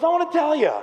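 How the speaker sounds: high, strained scolding; mock coach's reprimand; retold furious coach. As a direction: imitate an angry coach laying down the law. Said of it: "He's so furious with us".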